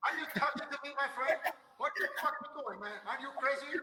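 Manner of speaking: hammed-up tough guy through laughter; Robert De Niro impression. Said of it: "your Robert De Niro impression".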